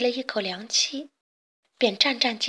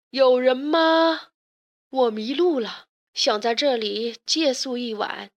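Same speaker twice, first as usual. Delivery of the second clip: drawn-out, raised call into emptiness; calling out loudly. A narrator's reading. Lost traveler asks for a night's lodging, calling out loudly. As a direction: call out to whoever might be there. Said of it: "一边大声地问道".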